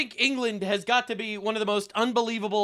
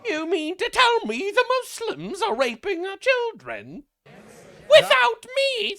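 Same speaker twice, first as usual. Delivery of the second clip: high, slow hammed-up indignation rising to a shout on the punchline; mock outraged Brit; 13 semitones higher, 5 dB louder than usual. Joking vlogger mocks a shocked Briton, hammed-up outrage. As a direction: play a scandalised gent who's upset he was left out. Sorry, that was a joke.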